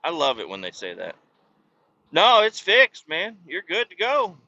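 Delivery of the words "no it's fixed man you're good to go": mocking mechanic. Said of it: "he said".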